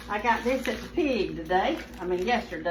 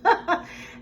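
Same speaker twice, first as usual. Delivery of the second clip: short breathy giggle; a little laugh at her own joke; 7 semitones higher than usual. Real, not acted. Cook laughs at her butt-rubbing joke, breathy giggle.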